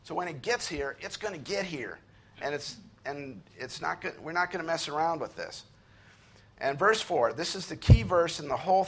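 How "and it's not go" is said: usual speaking voice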